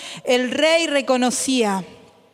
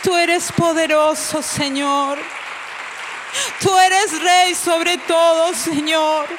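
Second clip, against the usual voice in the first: fervent worship prayer; 5 semitones higher than usual. Acted. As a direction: cry out praise with full force. Leads worship prayer with music.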